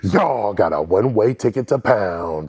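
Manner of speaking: slow, drawn-out showman delivery; signature catchphrase voice. Shown as a demonstration. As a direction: announce it big, stretching the punchline. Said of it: "ask me to say the second part".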